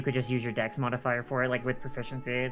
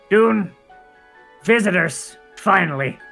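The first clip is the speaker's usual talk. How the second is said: gruff voice; 3 semitones higher, 5 dB louder, slower than usual. Acted. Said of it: "you hear a gruff voice".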